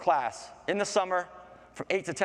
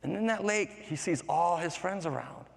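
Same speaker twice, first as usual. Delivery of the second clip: usual speaking voice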